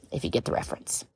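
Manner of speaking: breathy whisper under her breath; voiceless aside to listeners. Real, not acted. Whispered aside after song quote.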